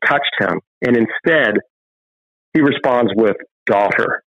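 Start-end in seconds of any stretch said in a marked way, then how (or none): 3.7-4.2 a touch of tender warmth. Retold or imitated. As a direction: say it like a loving father. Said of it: "He calls her daughter".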